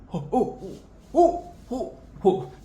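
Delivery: high startled oh-oh cries; mock flustered villain; hammed-up shock; imitating Tai Long's panic. Tai Long shook, voiced quote.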